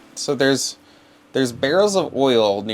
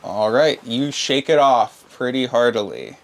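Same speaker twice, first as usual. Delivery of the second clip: ordinary delivery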